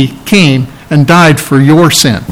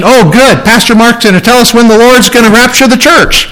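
imitating an eager listener, higher and lightly sing-song; mock eager churchgoer; 6 semitones higher than usual. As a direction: poke fun at someone hoping for dates. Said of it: "if you've come saying".

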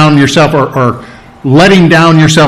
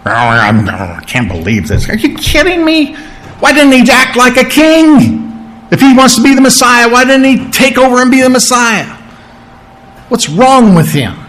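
mock crowd grumbling about Jesus; 9 semitones higher than usual. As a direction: gripe like disappointed followers. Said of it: "what would they do".

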